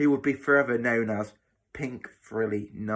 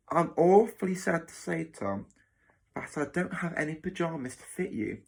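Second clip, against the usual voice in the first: higher-pitched false sympathy; mock regret; 6 semitones higher than usual. Matron pretending regret over missing pyjamas, insincere. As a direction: feign sorrow while enjoying it. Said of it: "a suspicious grin on her face".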